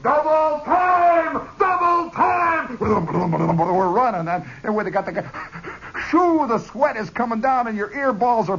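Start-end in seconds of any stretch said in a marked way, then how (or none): 0.0-2.8 slow, drawn-out high drill command; hollering order. Retold or imitated. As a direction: stretch the order out like a drill shout. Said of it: "he hollers".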